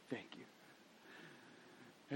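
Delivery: whispered, breathy thanks; soft thank you. Retold or imitated. Father thanking his little daughter in their copying game, whispered. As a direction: hush it back to her gently. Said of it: "dad answers softly in game".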